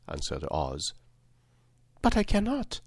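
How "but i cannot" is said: high-pitched startled protest; greatly surprised. Acted. Girl protests Oz's order to kill witch, greatly surprised. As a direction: exclaim in a child's high voice, taken aback. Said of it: "exclaimed Dorothy, greatly surprised".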